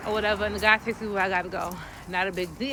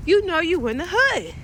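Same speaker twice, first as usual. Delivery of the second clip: higher and louder punchline; emphatic punchline; 9 semitones higher, 6 dB louder than usual. Real, not acted. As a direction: hit the punchline hard. Punchline to the audience.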